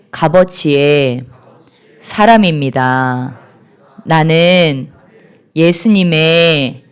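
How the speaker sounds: slow, low declaration in short phrases; measured, solemn repeat-after-me delivery; leading a congregational declaration. Acted. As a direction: lead them phrase by phrase, drawing out each ending.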